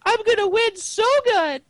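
very high, slow whiny princess voice; squeaky cartoon princess mockery; Lumpy Space Princess voice. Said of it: "Was that the Lumpy Space Princess voice?".

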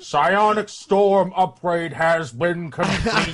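slow, measured announcer voice; mock game announcer. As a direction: read it out slowly like an in-game notice.

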